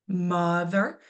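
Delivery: mother with a strong MO; stress on first syllable. Shown as a demonstration. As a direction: repeat mother leaning on MO. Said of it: "stressed syllable is that strongest".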